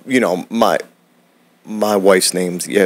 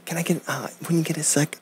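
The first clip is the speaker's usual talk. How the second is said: much softer, higher, overly polite request; 5 semitones higher, 12 dB quieter, faster than usual. Shown as a demonstration. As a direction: shrink the ask down to almost nothing.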